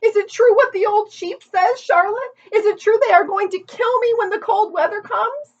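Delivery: fast, desperate questions; frantic pleading. A narrator's reading.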